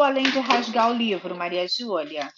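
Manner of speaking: usual speaking voice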